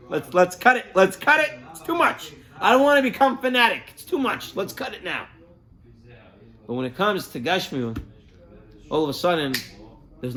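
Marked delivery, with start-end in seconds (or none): 0.1-5.3 mock lax voice. Voicing lax people.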